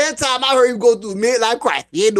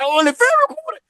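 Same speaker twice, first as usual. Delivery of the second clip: incredulous; 13 semitones higher than usual. Retold or imitated. Retold phone argument, pitch jumps.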